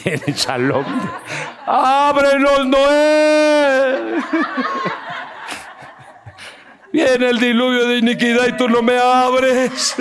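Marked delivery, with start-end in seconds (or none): 1.7-10.0 laughing shout. Acted. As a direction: yell laughing to be let in. Joking knocking plea.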